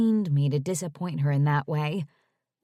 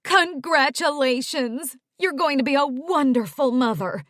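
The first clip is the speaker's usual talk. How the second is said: joyous; 7 semitones higher, 5 dB louder than usual. Acted. Mom cutting in on the phone, joyous congratulations. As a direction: gush with delight. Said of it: "saying joyously".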